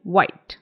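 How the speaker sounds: "व्हाइट" with lips rounded, no buzz. Shown as a demonstration. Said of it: "lips को थोड़ा सा round करना है". ("व्हाइट" होंठ गोल करके)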